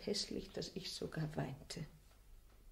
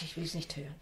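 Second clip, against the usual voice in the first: normal talking voice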